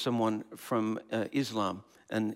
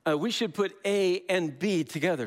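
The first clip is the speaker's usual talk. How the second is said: mock insistent objector; 7 semitones higher than usual. Someone insisting A and B belong together, pitched high. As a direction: play the know-it-all who merges A and B. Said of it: "And they say".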